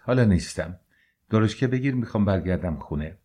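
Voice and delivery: ordinary delivery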